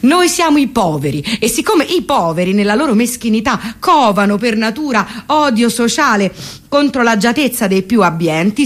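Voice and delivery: ordinary talk, nothing marked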